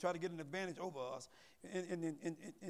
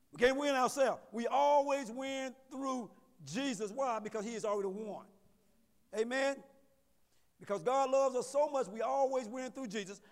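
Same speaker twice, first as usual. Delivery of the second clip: loud, emphatic sermon delivery; preaching; 3 semitones higher, 5 dB louder than usual. Real, not acted. Preacher declaring victory to the congregation, preaching loudly. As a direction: preach it out with force.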